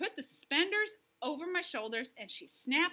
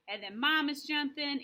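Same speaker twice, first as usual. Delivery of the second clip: ordinary delivery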